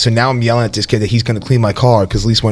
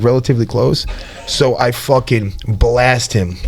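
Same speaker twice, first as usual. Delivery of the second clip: ordinary talk, nothing marked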